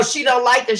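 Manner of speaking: usual speaking voice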